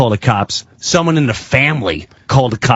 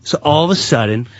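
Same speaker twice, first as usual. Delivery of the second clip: ordinary talk, nothing marked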